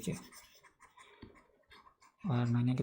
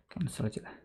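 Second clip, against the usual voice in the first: whispered aside; 11 dB quieter than usual. Real, not acted. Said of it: "muttered aside to herself".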